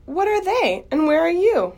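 loud, very high-pitched questioning yell; frantic scream. Acted. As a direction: cry out in bewildered desperation. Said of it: "screamed Wilbur".

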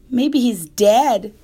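loud, slow morbid guess; blunt kid. Acted. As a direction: drawl it out carelessly. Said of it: "said Avery".

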